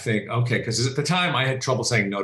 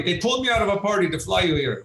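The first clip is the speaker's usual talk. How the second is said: imitated gung-ho pilot; 7 semitones higher than usual. Acting pilot pulled out of party.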